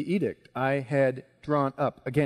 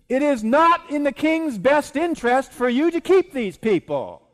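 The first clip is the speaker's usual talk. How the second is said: loud, raised-pitch persuasion in punchy repeats; forceful accusation; 8 semitones higher, 8 dB louder than usual. Acted. Haman urging the king against a people, loud and insistent. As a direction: press the case hard, building in pitch.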